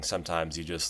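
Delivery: normal talking voice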